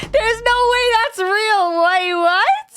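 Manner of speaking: high, loud, incredulous and still laughing; can't believe what she just read; stunned disbelief. Real, not acted. Reacting to absurd post.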